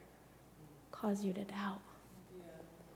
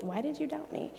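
higher, softer mild reproach; disappointed; 5 semitones higher, 5 dB quieter than usual. Acted. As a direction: ask as if let down by a friend. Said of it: "like, disappointed with us".

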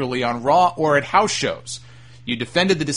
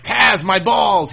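loud, high, drawn-out pained holler; mock wrestler yelling in pain; imitated shout; 6 semitones higher, 6 dB louder, much slower than usual. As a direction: shout it over to someone, stretching the words. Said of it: "He's shouting over to Taz".

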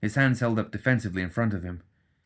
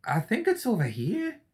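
confused; 12 semitones higher than usual. Acted. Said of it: "A confused voice drifted".

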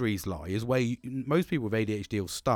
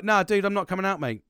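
louder, higher laid-back brush-off; casual dude voice; 6 semitones higher, 6 dB louder than usual. Shown as a demonstration. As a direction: toss it off like a carefree mate. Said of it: "That's if I say it, like, a little bit like that".

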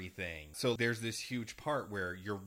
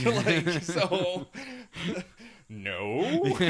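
bursting into high, loud laughter; can't get the words out for laughing; real laughter; 10 semitones higher, 10 dB louder, much slower than usual. Real, not acted. Podcaster cracking up at the uncle's line, laughing.

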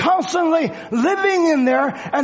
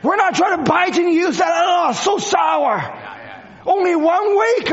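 fiery preaching; 6 semitones higher than usual. Acted. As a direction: preach it out with full force. Preaches at full force.

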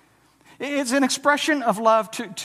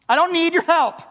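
curt, higher and louder refusal of help; proud refusal; 6 semitones higher, 4 dB louder than usual. Retold or imitated. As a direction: toss it off like a proud man brushing off help. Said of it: "touches on pride in our life".

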